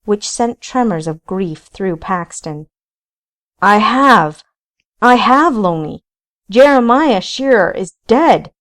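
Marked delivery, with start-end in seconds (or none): 3.6-8.5 loud, pleading cry of defence; desperate shout. A narrator's reading. Paxton shouts back at the ghosts, desperate and loud.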